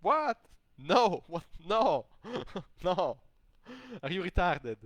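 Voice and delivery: loud, high, mock-outraged shouting; incredulous outburst. Imagined reaction to the man's theory, loud incredulity. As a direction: explode in disbelief, laughing a little. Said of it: "I would be like".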